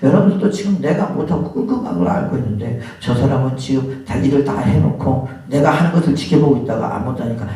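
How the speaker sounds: ordinary talk, nothing marked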